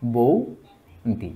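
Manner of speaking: বৌদি stretched out slowly and softly. Shown as a demonstration. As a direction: draw out বৌদি for the class. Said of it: "ব্যঞ্জনচ্যুতি হয়ে ধ্বনি পরিবর্তন". (বৌদি খুব ধীরে টেনে)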